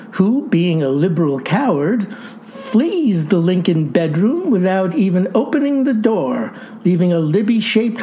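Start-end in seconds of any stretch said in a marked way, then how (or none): none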